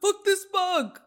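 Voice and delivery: high, loud startled cry; dramatic freak-out. Retold or imitated. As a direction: overreact loudly to a bug, high-pitched. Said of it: "call me dramatic all the time".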